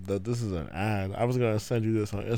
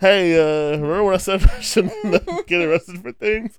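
sheepish confession; 8 semitones higher, 5 dB louder than usual. Pretend. Acted skit, confession to partner.

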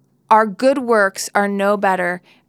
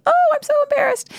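squeaky high, fast mock-mortified friend; mock embarrassment; faster than usual. Congregant embarrassed about broccoli in teeth, squeaky mock mortification. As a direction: play a flustered friend, way up high.

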